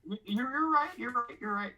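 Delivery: exasperated concession. Real, not acted. Conceding loudly to GM.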